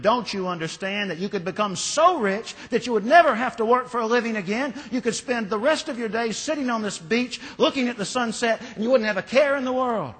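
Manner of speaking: shouted in rage. A narrator's reading. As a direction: yell at him, red with rage. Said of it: "he shouted at the fisherman".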